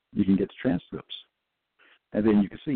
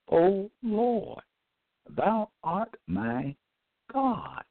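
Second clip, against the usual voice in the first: preaching invocation; 12 semitones higher, much slower than usual. Real, not acted. Preaching scripture, high and slow.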